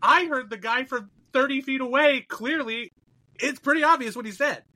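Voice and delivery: high, indignant retold rant; exasperated protest. Retelling outrage at rude lady, exasperated and high.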